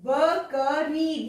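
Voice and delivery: drawn out slowly, sound by sound; slow careful reading. Shown as a demonstration. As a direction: sound out बकरी slowly, syllable by syllable. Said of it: "ध्यान से शब्दों को पढ़ेंगे".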